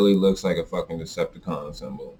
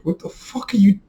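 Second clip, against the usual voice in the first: shocked outburst. Retold or imitated. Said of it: "you would be like".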